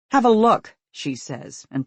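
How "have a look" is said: loud curt command; sharp angry order. A narrator's reading. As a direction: throw it at him angrily. Angry mother tossing phone.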